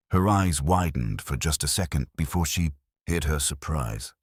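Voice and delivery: usual speaking voice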